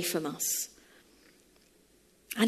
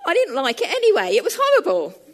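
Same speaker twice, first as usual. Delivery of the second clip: dismissive; 6 semitones higher, 7 dB louder, faster than usual. Retold or imitated. Dave dismissing the pot.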